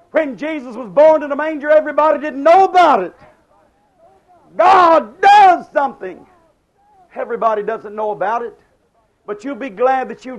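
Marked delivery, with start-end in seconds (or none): 0.1-6.3 louder and higher, swelling to a cry; building preaching. Acted. Preacher repeating his point to the congregation, building loud. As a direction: lift it the second time like a revival peak. Sermon line repeated and built.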